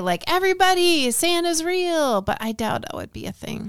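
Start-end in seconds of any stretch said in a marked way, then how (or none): 0.3-2.2 joyful shout. Retold or imitated. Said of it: "screaming out the window with, like, joy".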